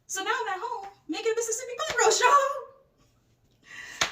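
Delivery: very high, jubilant crowing; triumphant. Real, not acted. Storyteller telling viewers she's cooking the roast, high triumphant crowing. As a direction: crow the happy ending in a high wail.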